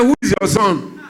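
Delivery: put-on high, indignant boy's voice with a laugh; angry teenage son. Teenage son challenging his doctor mother, high and scornful. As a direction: fling the question back at the parent, mocking. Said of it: "The boy said".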